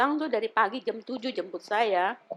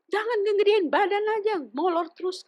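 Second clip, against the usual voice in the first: mock nagging; 12 semitones higher than usual. Friend scolding, retold.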